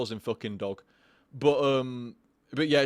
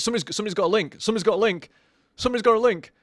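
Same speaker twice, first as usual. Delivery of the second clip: excited; 6 semitones higher, 5 dB louder, much faster than usual. Real, not acted. Excited at chat's link.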